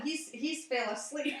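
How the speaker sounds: ordinary talk, nothing marked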